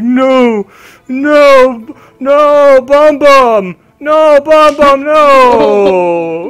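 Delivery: high, loud anguished cries of no; grieving ogre wail. Acted. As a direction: wail over a fallen pet. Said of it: "He's like".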